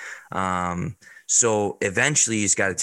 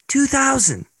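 amazed; 4 dB louder than usual. Real, not acted. Marveling at the kid's work.

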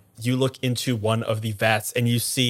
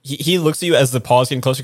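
usual speaking voice

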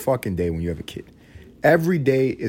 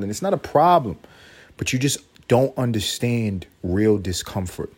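usual speaking voice

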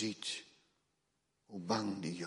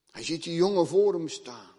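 high, loud, urgent sermon voice; fiery preaching; 10 semitones higher, 12 dB louder than usual. Acted. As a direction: drive the story home from the pulpit. Preaching at full force.

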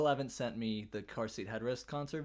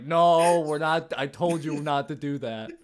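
loud; 3 semitones higher, 10 dB louder than usual. Retold or imitated. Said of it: "you're gonna be like".

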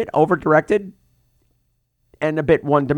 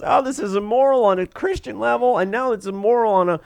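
high, hand-wringing fret; mock fretting lady; 7 semitones higher than usual. Doctor Mommy torn between her faith and her job, fretting. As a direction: agonize over morality in a prissy voice. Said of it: "torn in two directions".

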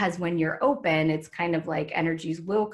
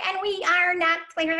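high, squeaky channeling voice, pitched way up; 12 semitones higher, 3 dB louder than usual. Retold or imitated. Vlogger mimicking a channeler's early recordings, high pitched. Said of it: "like, really high pitched".

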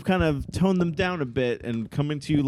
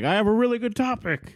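bad Ethan impression; 9 semitones higher than usual. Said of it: "that was a bad Ethan".

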